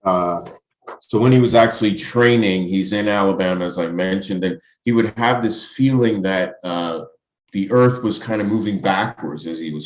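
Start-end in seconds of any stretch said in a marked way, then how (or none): none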